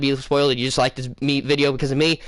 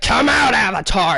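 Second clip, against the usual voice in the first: Fire Lord shout; 4 semitones higher than usual. Retold or imitated. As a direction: call him out. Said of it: "he's like".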